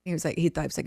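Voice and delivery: ordinary delivery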